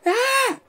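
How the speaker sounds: very high, squeezed mock scream; imitated scream. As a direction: re-enact a sudden shriek, holding the volume back. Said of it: "But I screamed, like".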